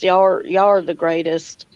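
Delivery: ordinary talk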